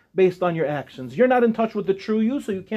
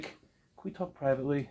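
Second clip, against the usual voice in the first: very soft, lower, discreet request; hushed, confidential tone; 6 semitones lower, 12 dB quieter than usual. Acted. Student quietly asking a teacher for a word, hushed and confidential. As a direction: ask it under your breath, as a private word. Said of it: "it has that tone, that cadence".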